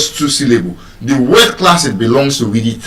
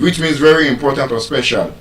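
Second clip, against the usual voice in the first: ordinary delivery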